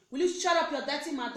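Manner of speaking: loud mock telling-off; angry scolding. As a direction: act out a man's angry rebuke. Imagined man scolding a woman.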